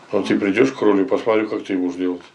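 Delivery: ordinary talk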